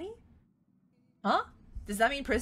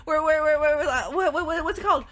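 normal talking voice

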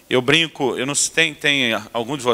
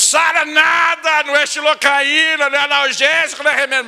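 inner yell; 13 semitones higher, 3 dB louder than usual. Retold or imitated. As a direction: scream it at heaven in frustration. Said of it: "Eu quero gritar pra Deus". (grito exasperado, agudo e lento)

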